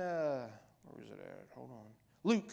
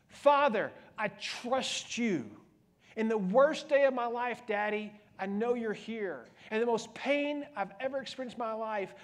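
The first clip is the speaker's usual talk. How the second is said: impassioned prayer; 5 semitones higher, 6 dB louder than usual. Acted. Voices Jesus praying on cross.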